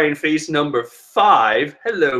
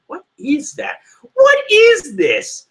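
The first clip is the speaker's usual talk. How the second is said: much higher and louder, a slow "What is this?"; baffled disbelief; 4 dB louder, slower than usual. Real, not acted. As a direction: stretch out your total disbelief. Baffled by a drawing.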